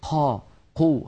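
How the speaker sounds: "قا، قو" with air rushing after the qaf; aspirated qaf. Shown as a demonstration. Teacher showing the incorrect habit, breathy qaf. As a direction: leak breath after each ق. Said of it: "يجعلون كمية من الهواء تتدفق".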